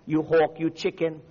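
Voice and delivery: ordinary delivery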